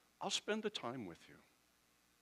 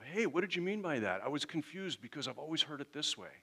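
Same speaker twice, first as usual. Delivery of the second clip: higher, louder puzzled questioning; raised-pitch, confused but willing asker; open inquiry; 6 semitones higher, 5 dB louder than usual. Acted. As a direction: act out someone earnestly checking their understanding. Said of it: "if you come with that attitude of".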